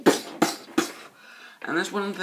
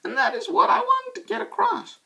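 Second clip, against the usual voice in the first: squeaky voice. Real, not acted. Sudden high squeaky aside.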